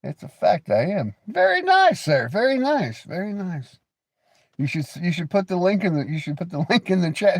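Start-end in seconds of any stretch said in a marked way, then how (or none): 1.3-3.8 high, put-on "very nice"; high mock praise; mock Borat voice. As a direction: ham up the foreign compliment. Jokey compliment to chat.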